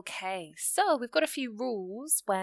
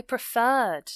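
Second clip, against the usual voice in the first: PREFERRED with its second syllable drawn out and louder; stress on second syllable; 3 semitones higher, 7 dB louder than usual. Shown as a demonstration. Teacher showing learners where the stress is, second syllable of "preferred" stressed. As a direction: lean slowly and firmly on the end of "preferred". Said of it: "the stress is on the R section".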